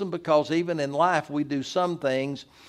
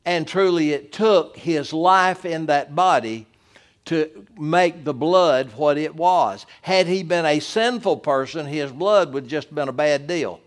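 louder, higher rising preacher cadence; preaching at full force; 4 semitones higher, 6 dB louder than usual. Acted. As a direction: build the sermon to a fervent climax. Pastor builds to full preaching.

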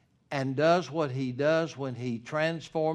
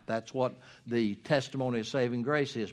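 normal talking voice

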